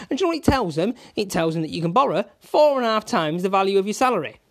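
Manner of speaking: furious rant at lazy brokers, loud and high; rising rant. Real, not acted. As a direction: let the anger boil over as you go. Ranting at brokers, building.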